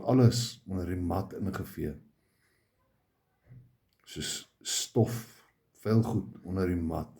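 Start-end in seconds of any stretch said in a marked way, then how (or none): none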